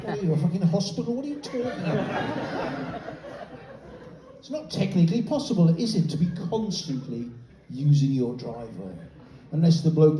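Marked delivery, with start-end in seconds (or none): none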